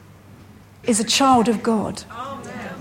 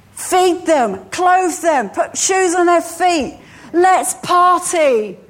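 joyful father; 5 semitones higher, slower than usual. Acted. Father welcomes the son home.

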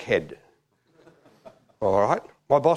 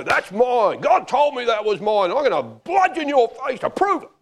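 higher-pitched, combative outburst; mock belligerent; 8 semitones higher than usual. Preacher mimicking someone fighting over their vision, mock belligerent. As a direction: stake your claim like a brawler.

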